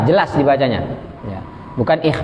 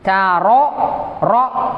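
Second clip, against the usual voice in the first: ordinary delivery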